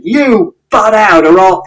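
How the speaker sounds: high, slow menacing yell; threatening shout. A narrator's reading. Father shouting a threat at Mister Shears. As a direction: warn him off, cut short. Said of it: "And Father shouted".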